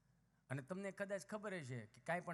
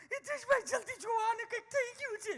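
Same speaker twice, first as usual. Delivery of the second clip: much higher, loud, shrill-sounding panic mimicked with laughter; mock a frantic city mother; panicked mother; 8 dB louder than usual. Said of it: "એટલે આમ હાથમાં લઈને". (ગભરાયેલી મમ્મીની ઊંચા અવાજે નકલ)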